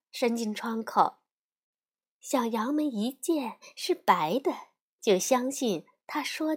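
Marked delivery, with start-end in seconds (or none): none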